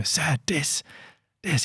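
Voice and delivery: proud "Sir, dis"; "dis" from a grateful boy; imitating the boy. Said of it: "and go".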